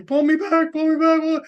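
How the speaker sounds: high, loud mock pleading; mock begging. Friend hung off the bridge begging, high panicky plea. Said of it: "he's begging, he's crying".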